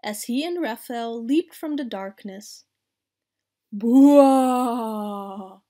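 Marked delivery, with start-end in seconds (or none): 3.7-5.6 long, loud ghostly boo; drawn-out boo. A narrator's reading. Raphael leaping from the darkness, long loud boo. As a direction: stretch the boo out loudly to scare. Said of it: "yelled Leonardo from behind the sheet".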